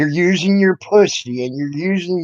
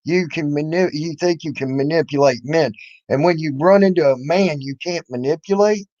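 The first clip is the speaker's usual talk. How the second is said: ordinary talk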